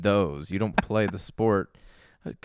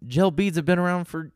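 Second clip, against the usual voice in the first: much higher, incredulous mocking tone; exasperated scoff; 9 semitones higher than usual. Real, not acted. Mocking Nike's new gel beads.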